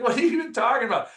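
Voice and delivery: high, fast surprised voice; friend brushing it off. Retold or imitated. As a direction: toss it off like it's nothing. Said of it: "she's like".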